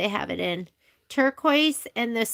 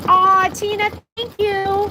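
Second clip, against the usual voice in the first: touched thanks; 8 semitones higher, 6 dB louder than usual. Real, not acted. Thanking a viewer warmly.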